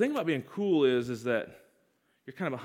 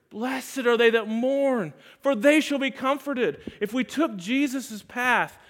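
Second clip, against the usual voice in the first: higher, louder fervent sermon voice; preaching at full force; 5 semitones higher, 5 dB louder than usual. Acted. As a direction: drive it home like a revival preacher.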